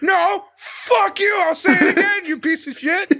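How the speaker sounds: high, shouted, laughing rant; mock shouting match. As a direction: go off on him like a drunk wrestler. Acted shouting match outside arena.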